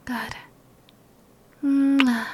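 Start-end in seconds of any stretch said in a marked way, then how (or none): 1.6-2.3 kiss sound. Acted. Kissing her partner.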